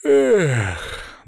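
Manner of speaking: long high yawn sliding down; mock-bored stagey yawn; theatrical yawn. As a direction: yawn it out showily, falling in pitch. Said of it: "театрально зевнул".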